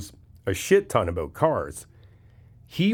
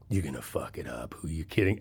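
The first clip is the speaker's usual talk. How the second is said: much lower, softer sneer; taunting inner critic; 7 semitones lower, 9 dB quieter than usual. Pretend. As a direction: taunt yourself in a low grumble. Inner bad guy voiced.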